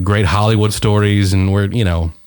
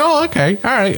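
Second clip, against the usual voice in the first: high-pitched taken-aback agreement; retold surprised acceptance; 11 semitones higher than usual. Reacting when he just starts talking, high surprised acceptance. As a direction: go up high, as if caught off guard.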